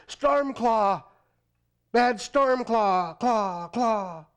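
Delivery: loud urgent cawing call; crow's warning cry. A narrator's reading. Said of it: "calling his ominous warning".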